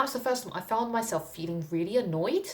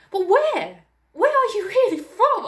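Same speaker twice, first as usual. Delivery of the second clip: much higher, louder mock insistence; insistent lady; 8 dB louder than usual. The European lady at Oxfam insisting, high loud mock voice. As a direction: squeal the prying question. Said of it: "she just was like".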